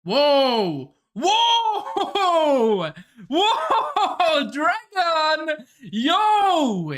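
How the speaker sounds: high, loud hyped whoas with laughter; real excited yelling at the screen; excited shouting. Real, not acted. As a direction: shout out in pure hype, laughing. Hyped reaction to the dragon scene.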